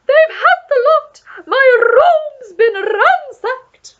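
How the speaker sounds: very high, loud, slow wailing shriek; Duchess screaming. A narrator's reading. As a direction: wail it like a distraught opera diva.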